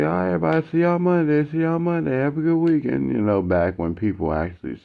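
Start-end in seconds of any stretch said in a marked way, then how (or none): 0.0-3.1 high, sing-song see-y'all-Monday; cheery goodbye. Retold or imitated. Classmates saying Friday goodbyes, high and cheery. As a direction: call out a bright farewell.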